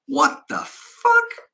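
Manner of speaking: high, drawn-out startled exclamation. Acted. George as the children burst in, startled. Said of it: "George is startled".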